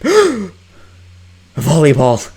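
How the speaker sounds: loud, slow gasped exclamation; surprised gasp. Acted. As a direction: gasp then marvel. Gasps at the sudden volleyball.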